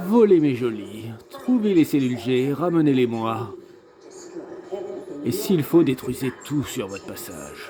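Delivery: a hint of a commanding game villain; mildly theatrical villain reading; lightly voiced alien villain. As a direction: read it as an alien overlord ordering minions. Reads the game villain's subtitles aloud.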